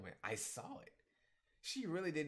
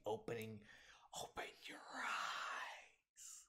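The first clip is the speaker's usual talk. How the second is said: mock whisper; 2 semitones lower, 6 dB quieter, much slower than usual. Mocking a character to look around.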